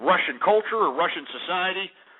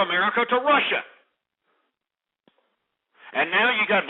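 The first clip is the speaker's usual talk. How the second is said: angry rant; 6 semitones higher than usual. Real, not acted. Radio host ranting about Russians and visas in a raised furious voice. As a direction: let the outrage run high.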